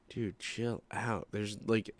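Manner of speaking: soft, slow, low thought to oneself; inner voice. Retold or imitated. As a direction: say it under your breath, unspoken advice. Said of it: "in my head, never said it out loud".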